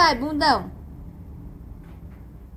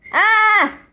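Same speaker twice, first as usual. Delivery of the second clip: loud, higher playful "aah"; demonstrated scream; 4 semitones higher, 7 dB louder than usual. Shown as a demonstration. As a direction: give a short mock scream for the baby. (gritinho alto de brincadeira)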